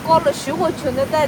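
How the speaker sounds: ordinary delivery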